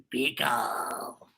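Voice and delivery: low, odd creature voice; a slow low creature repeating; Beagle's voice. Acted. Beagle repeating his name, low creature voice. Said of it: "That's how I imagine Beagle sound".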